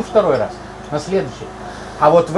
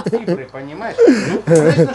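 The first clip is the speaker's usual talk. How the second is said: laughing; 4 dB louder than usual. Real, not acted. Still laughing at the joke.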